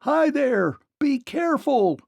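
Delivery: high, calling shout. A narrator's reading. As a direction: call out in alarm. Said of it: "shouted the Scarecrow".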